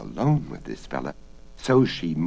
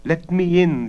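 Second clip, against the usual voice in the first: feeble imploring; 12 semitones higher, 8 dB louder than usual. Acted. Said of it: "the feeble voice implored".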